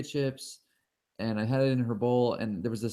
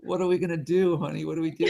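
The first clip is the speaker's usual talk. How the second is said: mock panic; 6 semitones higher, 3 dB louder, faster than usual. Retold panic to wife.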